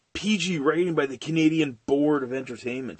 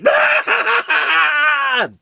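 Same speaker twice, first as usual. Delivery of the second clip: loud, high, drawn-out cackle; maniacal villain laugh; 11 dB louder than usual. Acted. Villain laughs anyway at the hero, maniacal cackle. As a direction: burst into a mad shrieking cackle. Acting Joker's laugh in a scene.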